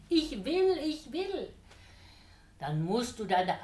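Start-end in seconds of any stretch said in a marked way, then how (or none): 0.1-1.5 eager eager yes, first higher and louder. A narrator's reading. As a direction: jump in eagerly like a pleased dog. Devil's eager interruption. (eifrig dazwischengerufen)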